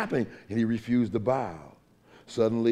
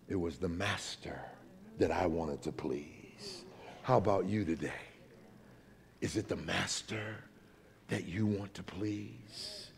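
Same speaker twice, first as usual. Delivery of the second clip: hushed sermon storytelling; 6 semitones lower, 11 dB quieter than usual. Acted. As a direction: drop almost to a whisper for the moral. Hushed telling of violinist's answer.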